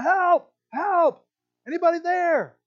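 high, loud mock-desperate calls; crying out for help. Jack hanging from a tree limb, crying out. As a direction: holler for rescue like a stranded man. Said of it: "he was crying out".

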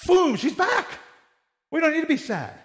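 high, excited imitation of himself; pumped up. Preacher imagining raising Emma, mock excited. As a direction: hype it up like he's showing off a miracle. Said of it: "I'd be pumped up".

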